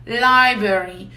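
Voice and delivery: "Library" said slowly as LYE-beri, first R nearly silent; slow model pronunciation. Shown as a demonstration. Teacher models "Library" for students, slow and clear. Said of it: "dificuldade por causa desse tanto de R".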